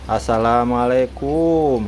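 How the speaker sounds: slow, sing-out assalamualaikum; drawn-out greeting. Shown as a demonstration. Owner models a greeting for the bird Jarwo, drawn out. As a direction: stretch the greeting out for a learner. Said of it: "gitu dong".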